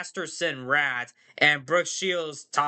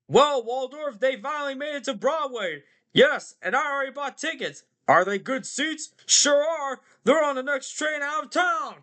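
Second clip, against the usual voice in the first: put-on high, heckling puppet old-timers; Statler and Waldorf; 10 semitones higher than usual. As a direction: trade jibes as two theater hecklers, voice pushed high. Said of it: "They're basically the theater guys, Statler and Waldorf".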